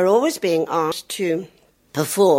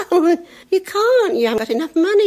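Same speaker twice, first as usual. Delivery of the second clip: mother's voice; 12 semitones higher, 5 dB louder than usual. Retold or imitated. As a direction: explain it gently to a small boy. Mother to son.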